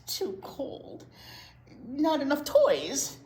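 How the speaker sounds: fussy pigeon. Acted. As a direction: whine about every bath detail.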